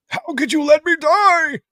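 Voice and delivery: high, drawn-out theatrical cry; hammed-up wail, loud and held high; anguished accusation. Comic reader voicing Jason confronting Batman, anguished cry.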